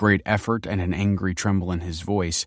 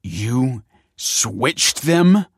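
slow, incredulous fury, rising at the end; louder and higher on a disbelieving question; fuming; 6 semitones higher, 7 dB louder, much slower than usual. A narrator's reading. Michael confronts Kelsey over the switched babies, fuming. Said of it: "fumed Michael".

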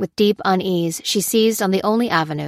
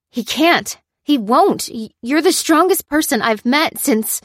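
high, loud, insistent protest breaking off; choking up, trailing off; 9 semitones higher, 6 dB louder than usual. A narrator's reading. Lucy encourages the prisoner until a name chokes her, distraught. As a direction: protest fiercely, then stop short. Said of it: "trailing off as Dan's name choked in her throat".

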